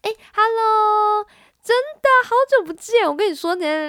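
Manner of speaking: put-on bright, high, bubbly girlish greeting; squeaky sweet and lively; high cute voice. Shown as a demonstration. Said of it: "大概是这种声音". (高音可爱的声音)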